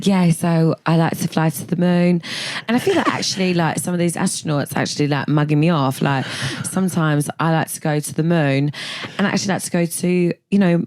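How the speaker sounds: chatty Essex astronaut; matter-of-fact Essex voice for a space traveller; Essex accent. Acted. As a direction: ramble in a broad Essex accent. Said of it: "is she from Essex?".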